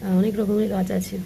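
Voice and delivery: hushed voice, keeping it down; speaking softly. Real, not acted. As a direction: talk quietly so nobody hears. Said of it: "তার জন্য একটু আস্তে আস্তে কথাবার্তা বলছি".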